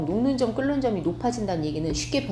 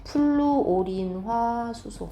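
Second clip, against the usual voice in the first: very slow 플루오린화 수소, syllables held; reading demonstration; 2 semitones higher, much slower than usual. Shown as a demonstration. Teacher showing class how the name reads, very slow demonstration. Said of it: "이렇게 읽어야죠".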